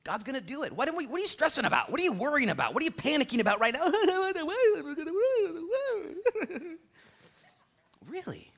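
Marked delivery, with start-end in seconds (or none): 0.7-3.9 fast, much higher, louder questions; challenging. Real, not acted. Preacher challenging worried listeners, fast high and loud. 4.0-6.8 loud high-pitched laugh. Real, not acted. Preacher laughing at the panicking congregation, high and loud.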